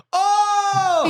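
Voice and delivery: put-on high, loud child's cry; hammed-up wail; mock toddler wail. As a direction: fake a little kid's hurt yell. Toddler acting hurt again.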